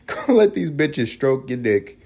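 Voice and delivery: slow, laughing through the words. Real, not acted. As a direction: say it while cracking up. Laughing through crude advice.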